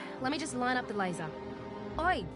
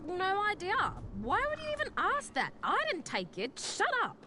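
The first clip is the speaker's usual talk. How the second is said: defensive; 9 semitones higher than usual. Acted. Accused about missing scanner.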